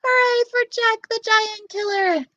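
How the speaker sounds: high, loud cheering; cheering crowd. Acted. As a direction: cheer like the whole village. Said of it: "they yelled".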